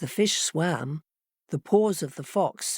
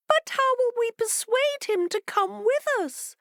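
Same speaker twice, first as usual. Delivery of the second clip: much higher, small fish voice crying out; crying fishes. A narrator's reading. Fishes questioning their master Leviathan, high and fretful. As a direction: squeak it out like worried little fish. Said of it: "they cried".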